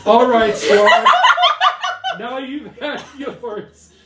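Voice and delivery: high, slow stage-hero voice; heroic Milo. Acted. Actor playing Milo confronting Strahd, slow heroic declamation. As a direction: make a slow grand entrance as the saviour.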